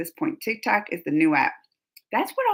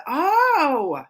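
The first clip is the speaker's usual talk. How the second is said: long high wail that falls away; loud dismayed "oh"; 5 semitones higher, 5 dB louder than usual. Real, not acted. Streamer reacting to the star's role, wailing oh. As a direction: cry out a drawn-out oh.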